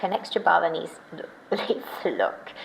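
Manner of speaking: whispered with a little laugh. Real, not acted. Whispering her joke about the clover to the viewers. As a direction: breathe it as a giggly aside.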